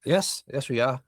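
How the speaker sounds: usual speaking voice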